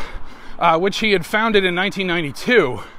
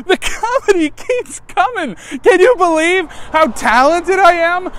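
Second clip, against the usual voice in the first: mock boastful; 11 semitones higher, 5 dB louder than usual. Self-mocking boast after joke.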